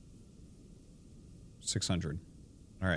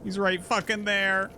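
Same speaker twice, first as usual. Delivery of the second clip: panicking gamer; 9 semitones higher than usual. Real, not acted. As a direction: panic at the monster in front of you. Monster appears, gamer freaks out.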